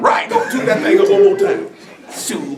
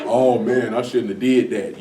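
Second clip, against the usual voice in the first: lower, softer, sorry-for-yourself groan; mock regret; 9 semitones lower, 6 dB quieter, slower than usual. Mocking the regretful sinner, low and softer. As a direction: play the guilty guy after the fact, low and deflated. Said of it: "Then you be like".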